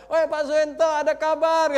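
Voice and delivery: full loud, high hollering; comic re-enactment of an excited shout; imitated yelling. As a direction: yell the news up from the stairs. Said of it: "Dia udah teriak".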